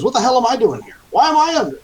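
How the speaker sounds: very high-pitched, agitated protest; bewildered high-voiced captive pleading his case; confused prisoner. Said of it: "He still looks pretty confused".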